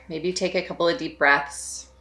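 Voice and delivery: soft, unhurried meditation voice with long pauses; hushed, spacious meditation guidance; guided meditation. Real, not acted. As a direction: gently guide them to settle and notice, leaving space between phrases.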